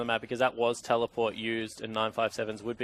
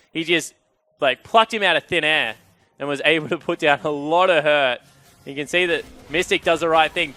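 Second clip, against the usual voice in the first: raised, loud caster voice; energised play-by-play; 6 semitones higher, 9 dB louder than usual. Real, not acted. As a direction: push the analysis out with post-fight excitement. Live analysis straight after the fight.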